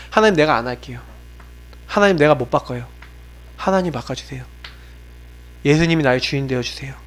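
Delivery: low, soft surrender to God; retold repentant prayer. Preacher re-enacts repenting before God, quiet and low. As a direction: drop the voice into a private prayer, pausing between pleas. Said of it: "진짜 고백했어요".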